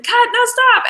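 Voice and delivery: high loud mock call to stop. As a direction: shout to halt the set. Retold calling out on set.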